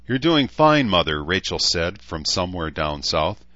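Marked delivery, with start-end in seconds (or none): 0.1-1.2 a hint of a higher, coaching daughter; lightly voiced daughter. A narrator's reading. Daughter coaching her mother through labour, lightly higher.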